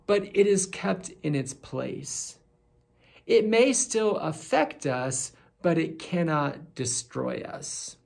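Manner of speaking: normal talking voice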